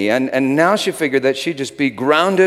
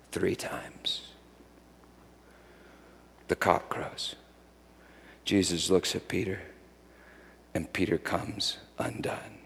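hushed whisper; 5 semitones lower, 12 dB quieter than usual. A narrator's reading. Hushed telling of the denial.

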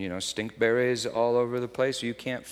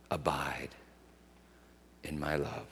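whispered, much softer; 7 semitones lower, 8 dB quieter than usual. Retold or imitated. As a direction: breathe it gently like a promise. Tender words to daughter.

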